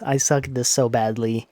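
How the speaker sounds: ordinary delivery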